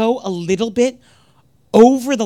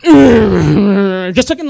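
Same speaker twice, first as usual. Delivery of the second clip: very slow, loud, zoned-out drawl; mock blissed-out stretch; dazed slow drawl; 9 dB louder, much slower than usual. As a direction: drift like a rolling raver. Imitating blissed-out ravers.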